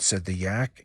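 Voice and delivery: ordinary delivery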